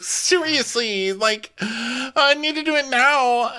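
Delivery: high, slow, whiny insistence; high drawn-out whine; mock desperate. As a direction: drag it out like a desperate child. Imitating desperate Casey.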